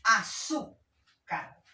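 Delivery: "Azúcar" slowly, loud "ZÚ", then a separate "car"; stressed-syllable demonstration. Shown as a demonstration. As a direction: lean hard on "zú" in "Azúcar". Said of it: "También acá lleva el acento".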